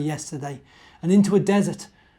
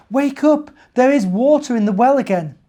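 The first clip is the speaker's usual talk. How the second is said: high, loud urgent wake-up call; small mouse excitedly waking the bear; 10 semitones higher, 10 dB louder than usual. Acted. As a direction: shake him awake with the news. Mouse rousing the sleeping bear.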